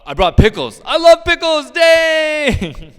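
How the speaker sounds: over-the-top cartoonish delight; silly excited coworker. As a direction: squeal it like a giddy kid over pickles. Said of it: "or, uh".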